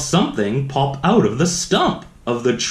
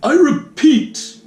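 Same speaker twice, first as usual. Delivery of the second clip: much higher, louder and slow; Lorax crying out; 12 semitones higher, 5 dB louder, slower than usual. A narrator's reading. Lorax repeating his warning to the Once-ler, crying out. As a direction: insist sharply, stretching it. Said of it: "cried the Lorax".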